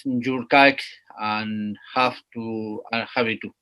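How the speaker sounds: slow, careful English with a Spanish accent; apologetic Spanish-accented English read. Real, not acted. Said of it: "pido disculpas por mi inglés".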